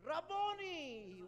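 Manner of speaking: Rabboni called out high and slow, the word stretched; Mary recognizes Jesus and cries out his name in wonder; crying out Rabboni. A narrator's reading. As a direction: cry out Rabboni, high and drawn out. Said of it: "cried out in Aramaic".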